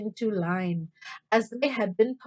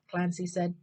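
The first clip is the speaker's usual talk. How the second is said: plain reading voice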